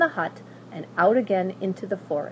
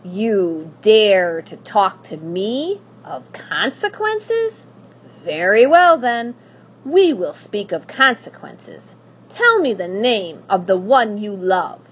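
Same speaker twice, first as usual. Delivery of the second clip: loud, slow, ominous; menacing witch; 8 dB louder than usual. Acted. Witch turning on the princess, loud and ominous. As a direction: rise up with cold menace.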